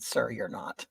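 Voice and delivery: low, hushed cutting retort; quiet put-down. Retold or imitated. Customer answers the market man in an argument, quietly cutting. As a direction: drop right down for the dismissal.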